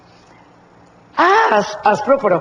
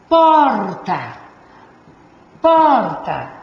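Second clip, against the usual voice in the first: "porta" with POR held long and loud; stress on POR; 4 dB louder, much slower than usual. Shown as a demonstration. Teacher showing students the tonic syllable, stressed POR in "porta". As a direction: lean hard on the first syllable of "porta". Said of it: "sílaba tônica é o por".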